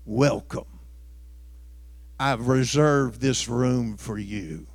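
softly gloating devil host; grinning Satan. Acted. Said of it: "Grinning from ear to ear".

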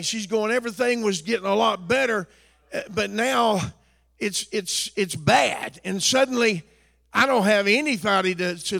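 loud, building sermon shout; fiery preacher climbing to a shout; preaching at full force. Acted. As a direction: drive it home to the congregation. Preaching again.

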